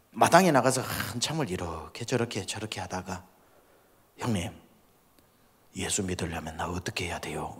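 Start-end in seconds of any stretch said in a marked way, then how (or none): 4.2-7.6 low, whispered and sheepish; timid question. Retold or imitated. Younger brother humbly asking how to believe, soft whisper. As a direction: ask meekly, barely voiced.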